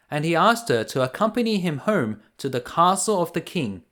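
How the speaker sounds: ordinary delivery, nothing marked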